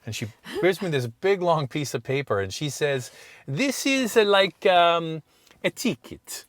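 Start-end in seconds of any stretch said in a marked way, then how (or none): 3.5-6.4 high, halting Italian clerk; Italian accent. Retold or imitated. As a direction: draw out the hesitation like a clerk searching for words. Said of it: "it sounded better when she says".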